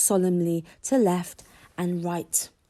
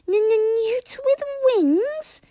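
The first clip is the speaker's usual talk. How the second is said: very high, slow stuttering squeak; stuttering dragon; 3 dB louder, much slower than usual. A narrator's reading. Toothless repeating the insult, high slow squeak. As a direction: squeak it out in offended disbelief. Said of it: "repeated Toothless".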